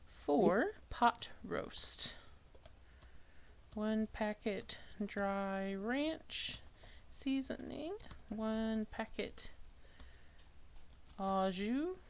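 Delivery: slow, soft, spelling it out while typing; slow dictation. Real, not acted. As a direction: read it out slowly as you type. Reads recipe aloud while typing.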